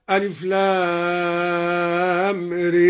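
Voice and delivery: "ر" said ree, with the a tilted to e; very slow recitation, long "لام", then "ر" as ree; imala on the raa. Shown as a demonstration. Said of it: "وأمال الراء في أول سورة إبراهيم". (إمالة "ر" مع مد "لام" مدا طويلا)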